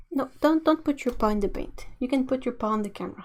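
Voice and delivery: ordinary delivery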